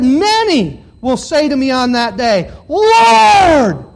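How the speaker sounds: loud quote rising to a high, long LORD; preached, full-voiced appeal; proclaimed scripture cry. Voices the pleading crowd's cry.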